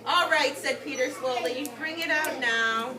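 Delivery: normal talking voice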